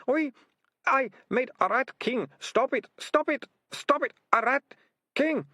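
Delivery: very high, frantic acted wail; tearful hysteria. Deputy ratcatcher breaking down as he confesses, hysterical. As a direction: cry out the confession in bursts. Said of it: "Tears ran down his face".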